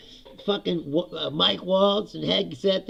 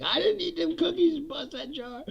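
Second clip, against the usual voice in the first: higher, whiny fake-innocent kid; kid caught lying; 10 semitones higher, 3 dB quieter than usual. Kid with cookies on his mouth denying it, higher whiny voice. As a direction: play the kid with crumbs on his face denying it. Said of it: "and he's like".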